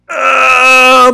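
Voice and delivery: a very high, loud wailing cry; anguished cry. Real, not acted. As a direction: let out a real cry of frustration.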